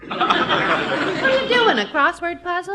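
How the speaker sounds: high, laughing teasing question; laughing tease. Acted. Wife teasing husband about his puzzling words, laughing. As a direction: laugh through the jab at him.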